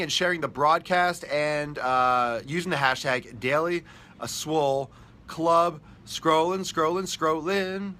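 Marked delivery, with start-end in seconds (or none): 4.3-7.9 drawn-out sing-song. Acted. Dragging out words while scrolling comments.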